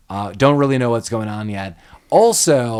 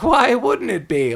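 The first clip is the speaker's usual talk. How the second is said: fake innocent; 10 semitones higher than usual. Hathaway feigning calm.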